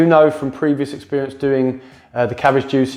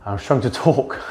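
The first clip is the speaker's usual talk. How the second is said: laughing; 3 semitones higher than usual. Real, not acted. Laughs at own braces lisp.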